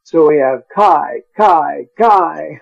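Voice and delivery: "kai" repeated, building to a shout; each "kai" hammered, the last one shouted; stressed repetition. Shown as a demonstration. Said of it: "many ands".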